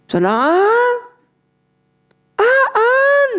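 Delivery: calling someone far away. Retold or imitated. Calling husband across the mall.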